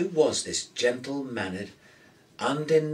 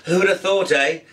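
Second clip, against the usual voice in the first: wry; 9 semitones higher, 7 dB louder than usual. A narrator's reading. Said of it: "he said wryly".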